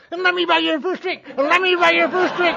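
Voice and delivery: high, loud heckler butting in; Rotten Ralph. Retold or imitated. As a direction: holler a taunt over someone's shoulder. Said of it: "out of nowhere comes this rotten Ralph".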